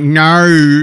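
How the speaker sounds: exaggerated Australian "No" as Americans do it; American mock Aussie accent. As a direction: badly imitate an Aussie "No". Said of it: "Americans try to imitate Aussies".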